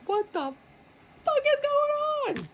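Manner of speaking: very high, slow shocked squeal; hammed-up shocked squeal; shocked outburst. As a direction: squeal it in disbelief. Voicing shocked querent.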